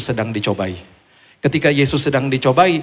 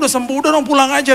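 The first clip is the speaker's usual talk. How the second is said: urgent plea; 12 semitones higher, 4 dB louder, faster than usual. Retold or imitated.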